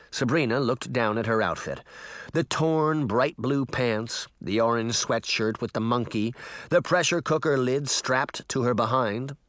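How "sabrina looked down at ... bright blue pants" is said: ordinary delivery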